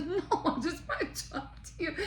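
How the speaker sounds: high, laughing plea; fake-calm reassurance cracking into giggles. The boss pleading through the door, laughing.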